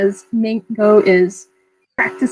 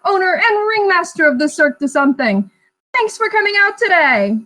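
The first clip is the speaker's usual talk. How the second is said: high, bright ringmaster showman voice; 5 semitones higher than usual. Acted. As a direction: sell it like a circus ringmaster. Ringmaster greets the party.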